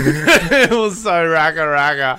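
slow, laughing through the words; cracking up while saying it. Real, not acted. Laughing about the chaos.

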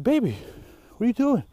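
startled and worried, calling to her; alarmed concern. Retold or imitated.

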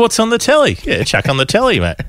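high, exaggerated Australian "telly"; broad Aussie. Co-host proving Australians say telly, broad Australian voice. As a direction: ham up a broad Aussie bloke. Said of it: "that's fucking Australian as".

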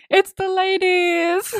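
high, drawn-out singsong announcement; gleeful, high-pitched reveal; excited cheer. Real, not acted. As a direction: hail their arrival, stretching it out high. Cheering the ladies' arrival.

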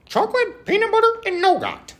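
high, loud gushing squeal; excited squeal. Real, not acted. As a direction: squeal the ingredients with delight. Excited hype over candy bar.

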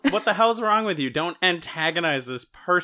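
loud, high telling-off of a friend; mock scolding. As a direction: yell at Barb to knock it off. Friend scolding drunk Barb.

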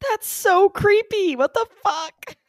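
high, drawn-out freaked-out squeal; slow, high squeal of horrified reaction; creeped-out outburst. Real, not acted. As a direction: recoil in high-pitched disbelief. Reacting to eerie patient story.

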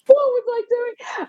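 high, slow, giggly disbelief; embarrassed, laughing at her younger self; laughing at herself. Real, not acted. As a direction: cringe and laugh it out. Recalling phoning David Mellor.

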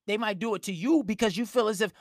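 ordinary talk, nothing marked

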